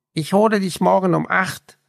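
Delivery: high, quick parting call; eager young man. A narrator's reading. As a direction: call it out cheerfully while riding away. Raphael calls out leaving.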